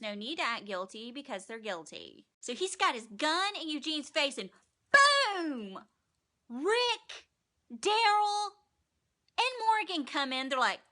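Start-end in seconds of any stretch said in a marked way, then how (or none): 4.9-10.0 loud, high dramatic reveal; dramatic announcer. Real, not acted. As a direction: announce the heroes bursting in.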